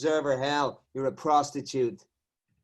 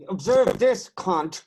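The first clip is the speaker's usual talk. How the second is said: loud, higher mocking yell; mocking shout; 5 semitones higher, 7 dB louder, slower than usual. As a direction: sneer it out loud. Cop mocking the observer.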